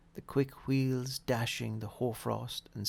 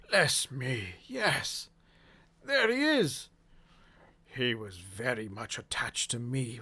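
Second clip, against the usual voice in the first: put-on fond old Scrooge. A narrator's reading. Scrooge fondly remembering old friend.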